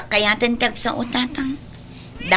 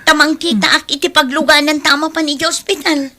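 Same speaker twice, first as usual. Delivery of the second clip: higher, louder hurried plea; urgent urging; 7 semitones higher, 4 dB louder than usual. Acted. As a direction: push him to hurry to the hospital. Sister urging brother to hurry. (nadagdagas nga panangiduron)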